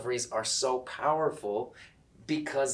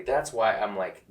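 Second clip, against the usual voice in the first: ordinary delivery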